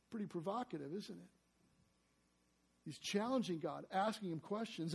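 ordinary talk

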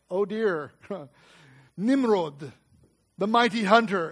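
ordinary talk, nothing marked

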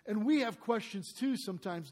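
ordinary talk, nothing marked

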